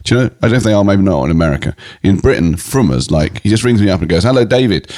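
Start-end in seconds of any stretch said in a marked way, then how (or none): 4.2-4.9 a touch higher, mimicking the rabbi's greeting; lightly put-on rabbi hello. Rabbi phoning David, lightly voiced greeting. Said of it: "I'm doing the voice and everything".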